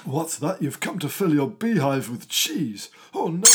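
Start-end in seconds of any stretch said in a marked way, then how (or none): 3.1-3.5 high, loud flustered protest; mock alarm. Storyteller referees squeaking moon creatures, loud mock alarm. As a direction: cut in loudly to break up a squabble.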